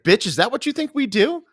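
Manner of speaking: camp, indignant comeback; high mock-outraged "Bitch"; mock sassy retort. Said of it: "I was like".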